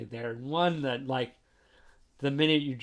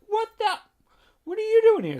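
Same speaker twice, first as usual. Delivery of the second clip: much higher, loud, shocked cry; startled outburst; 11 dB louder, faster than usual. Retold or imitated. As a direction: jump out of your skin at a sudden visitor. Aika suddenly appears next to her.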